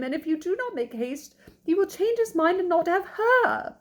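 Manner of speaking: very high, frantic wife's outcry; hammed-up shrieky panic; calling out. A narrator's reading. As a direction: call out in a high-pitched flap. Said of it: "called out as she entered the library".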